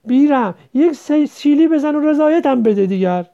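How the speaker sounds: loud, high coaxing plea; wheedling plea. A narrator's reading. Majid begs Qasem, loud and insistent. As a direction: beg insistently, pushing hard. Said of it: "حقه کنان".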